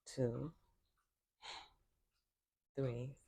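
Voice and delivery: calm, unhurried voice with long silences between cues; guided meditation. Real, not acted. Yoga teacher leading listeners into breathing, calm guided meditation. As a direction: count the breaths softly, leaving room to breathe.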